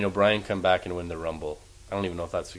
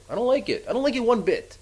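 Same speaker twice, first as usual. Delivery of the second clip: upset; 11 semitones higher than usual. Real, not acted. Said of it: "I'm a little emotional about it".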